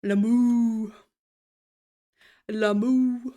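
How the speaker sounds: light mooing on a drawn-out name; playful cow moo. Pretend. Streamer teasing someone turned into a cow, playful mooing. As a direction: stretch the last syllable into a hint of a moo. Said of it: "Did you turn into a cow?".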